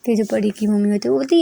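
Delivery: usual speaking voice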